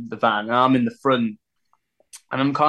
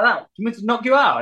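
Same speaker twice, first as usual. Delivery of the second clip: mock threat; 9 semitones higher, faster than usual. Stranger threatening him.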